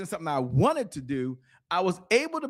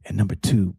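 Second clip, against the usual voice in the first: put-on a lower, hushed voice of the elderly mentor; old mentor; 10 semitones lower than usual. Elderly mentor advising a young speaker, lowered hushed voice. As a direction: lower your voice like the wise old man advising. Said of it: "He said".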